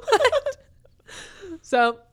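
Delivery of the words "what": a high, laughing "What?". Retold or imitated. Laughing shocked echo.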